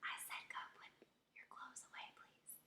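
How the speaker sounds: very soft, voiceless whisper. Shown as a demonstration. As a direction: whisper the order to the kids. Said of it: "or whisper".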